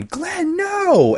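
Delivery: high retold scream; shrill mock scream of despair. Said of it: "Maggie scream".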